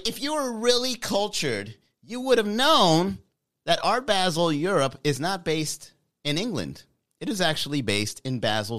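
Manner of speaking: high, nasal-free snooty pedant voice; nitpicking commenter. Retold or imitated. Commenter correcting Manny, high snooty voice. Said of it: "somebody in the comments would be like".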